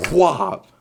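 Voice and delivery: loud high whop for the smack; slap noise. Retold or imitated. Teen describing dad's slap, loud slap sound.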